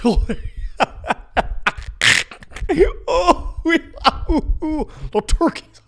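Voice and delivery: high, whooping mock laughter while talking; farmer laughing. Old farmer laughs at driver attacked by turkeys, cracking up. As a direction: imitate helpless laughter breaking up the words. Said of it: "So he's laughing".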